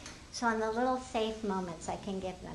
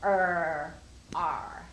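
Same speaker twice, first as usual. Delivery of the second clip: lightly growled er, er, er, ar; low pirate sounding out er and ar; pirate er sounds; 3 semitones lower, much slower than usual. Shown as a demonstration. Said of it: "sometimes the pirate goes".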